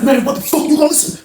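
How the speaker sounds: high-pitched mock yell; heated, high imitation of a threat; retold angry demand. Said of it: "he was like".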